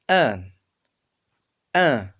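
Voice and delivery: آن said as a French nasal an, lengthened; nasal an sound. Shown as a demonstration. Teacher sounding out آن as a nasal French an for pupils. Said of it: "هنا سينطق".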